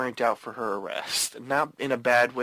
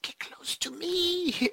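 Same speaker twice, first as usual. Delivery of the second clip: high, slow spooky lure; creepy voice; 4 dB quieter, slower than usual. Retold or imitated. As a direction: draw it out high and eerie. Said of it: "she keeps on saying".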